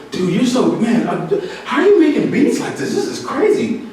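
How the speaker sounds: high, amazed praise with a laugh; amazed fan. Retold or imitated. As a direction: gush like a stunned fan. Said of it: "when someone says".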